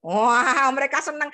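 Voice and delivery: ordinary talk, nothing marked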